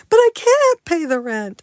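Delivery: high, loud pleading damsel; damsel in distress. Acted. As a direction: squeal it like a melodrama heroine. Melodrama skit, the victim.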